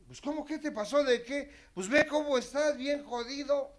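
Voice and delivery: high-pitched, incredulous friend; amiga alarmada. Retold or imitated. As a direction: push him to see how bad he looks. University friend confronts him. (amiga incrédula, voz aguda y alarmada)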